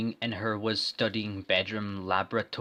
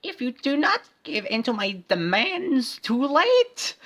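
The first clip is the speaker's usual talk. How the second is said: very high put-on villain voice; 5 dB louder than usual. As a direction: menace them in a high squeak. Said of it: "The Bad Woman laughed".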